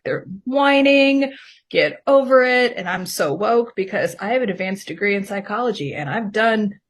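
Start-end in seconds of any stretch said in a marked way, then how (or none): 0.0-2.7 higher, louder mock scorn; drawn-out, dismissive imitation of inner judgment; judgmental. Woman airing her old thoughts about the meetings, mock judgmental. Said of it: "very judgmental thoughts in my head".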